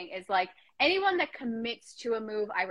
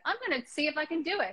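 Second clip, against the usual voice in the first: quick, higher Irish lilt slipping into Scottish; Irish turning Scottish; 6 semitones higher, faster than usual. Acted. Classmate recalls trying out accents, Irish sliding into Scottish. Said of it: "My Irish turned Scottish quite easily".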